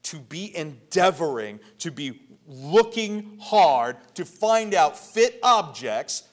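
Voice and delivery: loud, emphatic exhortation, phrase by phrase; preaching at full force. Real, not acted. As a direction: build in pitch and force towards the end.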